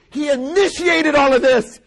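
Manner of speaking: high, loud climactic proclamation; full-force preaching cry; preacher's shout. Real, not acted. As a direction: belt it out from the pulpit.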